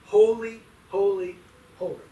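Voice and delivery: first Holy called loud, then easing off; crying out. Acted. Preacher voices angelic creatures in the throne room, crying out. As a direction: cry out three separate Holys, strongest first. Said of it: "angelic creatures crying out".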